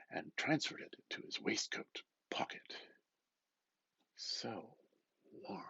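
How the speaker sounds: hushed, secretive whisper. Acted. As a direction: whisper it like hiding the card.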